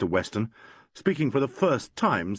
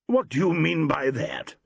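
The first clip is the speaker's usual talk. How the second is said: demanding; 7 semitones higher than usual. A narrator's reading. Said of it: "demanded Vernon".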